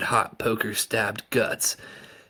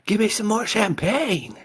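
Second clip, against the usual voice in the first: high, loud party demand for champagne; a high tipsy shout; tipsy woman calling out; 14 semitones higher, 5 dB louder than usual. A narrator's reading. Drunk party guest demanding.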